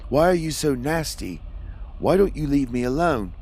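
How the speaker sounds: high, loud, upset retort; upset outburst, pitched well up; snapping back. A narrator's reading. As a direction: snap back at a cruel tormentor. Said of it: "I snapped back".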